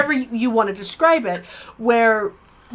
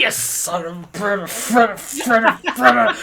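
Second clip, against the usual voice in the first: mock jealous curse. Mock jealous cursing at partner.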